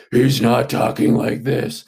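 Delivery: put-on superhero voice, not your own; exaggerated Batman voice. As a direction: overdo the Batman voice, as a mocking example. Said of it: "He just kinda talks in his regular voice".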